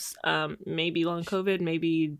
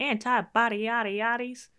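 quoting Ernestine; 6 semitones higher than usual. Retold or imitated. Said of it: "She was like".

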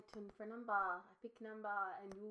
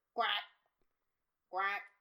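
quack sound; 8 dB louder than usual. Pretend. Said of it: "it'll just be like".

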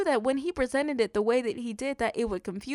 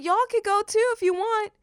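higher and louder, breezy and unbothered; confident shrug; 10 semitones higher, 5 dB louder than usual. Pretend. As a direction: toss it off like you don't care who leaves. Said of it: "so confident".

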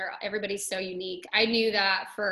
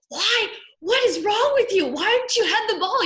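high, loud mock outrage; retold yelling; 13 semitones higher, 7 dB louder than usual. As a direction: imitate someone yelling in disbelief from the field. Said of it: "somebody yelled at you".

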